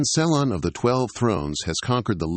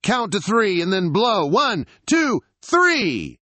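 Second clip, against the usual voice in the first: high, loud counting yell; rally everyone to blow the candles; 12 semitones higher, 4 dB louder, slower than usual. Acted. Girl yelling the count for the birthday candles, loud. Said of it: "Yoon Ha yells loudly".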